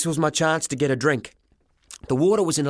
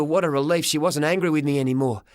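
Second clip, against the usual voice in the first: usual speaking voice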